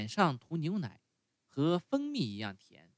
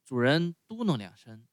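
usual speaking voice

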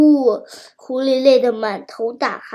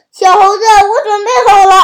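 higher and louder eager shout, a laugh in it; loud call; 6 semitones higher, 7 dB louder than usual. A narrator's reading. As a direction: call up to the monkey, mouth wide open. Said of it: "大声说". (狐狸张大嘴朝小猴子大声喊)

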